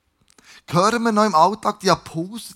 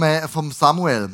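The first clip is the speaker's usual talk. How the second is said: ordinary talk, nothing marked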